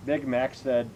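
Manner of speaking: ordinary delivery, nothing marked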